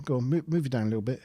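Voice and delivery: normal talking voice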